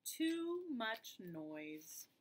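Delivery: drawn-out title, very slow; slow title read. Shown as a demonstration. Teacher announcing the story title, very slowly. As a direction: stretch each word of the title. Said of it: "We're ready to start our story".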